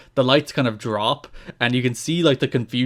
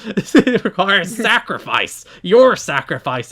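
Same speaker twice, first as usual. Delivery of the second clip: slow, higher, louder dramatic redo; hammed-up villain; 5 semitones higher, 5 dB louder, slower than usual. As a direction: ham up the sacrifice line. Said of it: "sounds more like James Mitchell".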